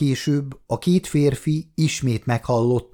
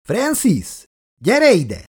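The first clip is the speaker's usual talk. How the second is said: high, louder call over the shoulder; shouting; 9 semitones higher, 4 dB louder, slower than usual. A narrator's reading. Tommy shouting back to his brother to come see. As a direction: call him over loudly. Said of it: "kiáltott hátrafelé".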